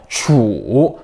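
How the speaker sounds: 추 low dip, then up; third tone. Shown as a demonstration. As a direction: sink 추 low and let it rise. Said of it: "삼성".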